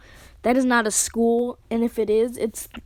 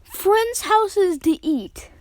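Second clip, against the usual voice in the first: shocked disbelief; 9 semitones higher, 3 dB louder, much slower than usual. Real, not acted. Shocked echo of narrator's line.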